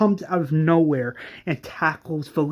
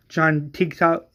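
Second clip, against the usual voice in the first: ordinary delivery, nothing marked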